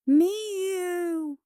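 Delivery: high, long-drawn mew; cat meow. A narrator's reading. Fed cat looks at Winnie, high meow.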